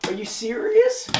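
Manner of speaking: can't believe he missed; disbelief. Real, not acted.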